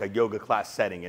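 ordinary talk

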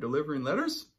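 ordinary delivery

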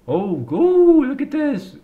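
high, slow amazed gasp; excited. Real, not acted. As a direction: wonderstruck high exclaim. Finds a gold mine.

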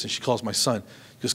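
ordinary talk, nothing marked

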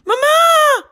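put-on high, loud child's shout of Mama. A narrator's reading. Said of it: "roept Anna".

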